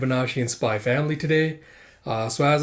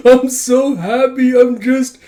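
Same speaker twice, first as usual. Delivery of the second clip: high, loud, drawn-out blubbering; hammed-up happy wail; 11 semitones higher, 11 dB louder, slower than usual. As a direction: wail with mock overwhelmed joy. Sobbing pupil overjoyed.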